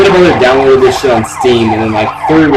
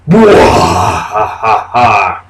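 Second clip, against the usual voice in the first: slow, hammed-up villain laugh; a drawn-out, half-practised "bwa-ha-ha"; evil laugh; 4 semitones lower than usual. Shown as a demonstration. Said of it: "no judgment on my evil laugh".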